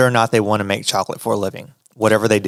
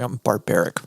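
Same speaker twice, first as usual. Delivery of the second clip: ordinary talk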